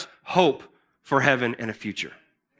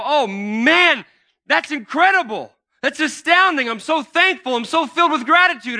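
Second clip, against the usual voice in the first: high, loud, gushing with thanks; excited gratitude; 8 semitones higher, 4 dB louder than usual. Retold or imitated. Preacher acting out a believer's excited thanks, gushing. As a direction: burst out with amazed thankfulness. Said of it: "And I get excited and I go".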